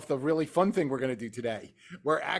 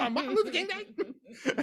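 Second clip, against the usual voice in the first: Southern mama voice. Retold or imitated. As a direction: ham up a Southern mom who can't believe it. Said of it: "she did her best Southern mama voice".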